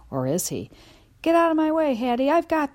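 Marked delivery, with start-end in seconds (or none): 1.2-2.7 high, loud brave order; bold shout. A narrator's reading. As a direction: puff up and shout it. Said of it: "I bark".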